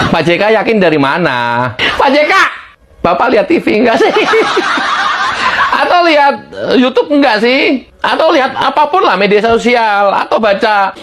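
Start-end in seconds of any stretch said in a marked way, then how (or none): 4.1-5.8 short breathy laugh rising; high laugh. Real, not acted. Host mocking JK's sources, amused laugh. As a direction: laugh at the absurdity. Laughs while asking about TV.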